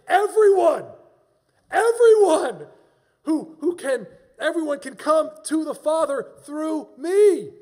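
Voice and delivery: full-force high preacher's shout; impassioned preaching. Real, not acted. Preacher proclaiming that everyone can come, loud and impassioned. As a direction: cry it out to the whole room, loud and ringing.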